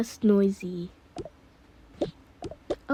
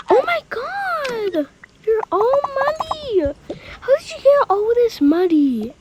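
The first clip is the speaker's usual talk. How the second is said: shocked scolding; 12 semitones higher, 5 dB louder than usual. Pretend. Caregiver finding Lacey all muddy, shocked high outcry. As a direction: squeal in mock horror, growing louder. Discovers child covered in mud.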